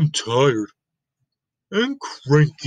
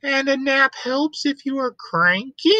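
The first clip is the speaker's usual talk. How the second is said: squeaky high piglet; a curious little pig asking; high Piggy voice; 12 semitones higher than usual. Acted. Piggy asking Elephant Gerald, high and curious.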